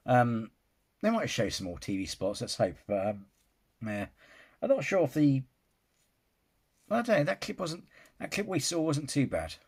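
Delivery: ordinary delivery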